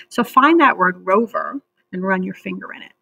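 ordinary talk

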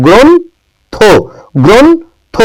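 গ্রন্থ in long high beats, "gron... tho"; pronunciation demo. Shown as a demonstration. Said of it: "কি এর মতো বলছি?".

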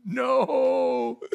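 high, drawn-out wail of "no"; mock wailing. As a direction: cry out in hammed-up despair. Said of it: "They're just like".

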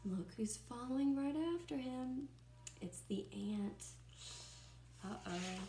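ordinary delivery

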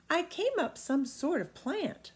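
put-on high boyish voice; a small boy correcting the giant; boy Jim answering the giant; young boy.

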